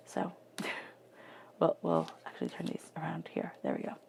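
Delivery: whispered aside while moving the cards. Real, not acted. Tarot reader whispering to herself as she turns the cards. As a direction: breathe it out under the voice.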